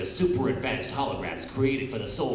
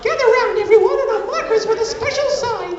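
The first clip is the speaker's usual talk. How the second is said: put-on high girly voice; 7 dB louder than usual. Tea's friendship speech.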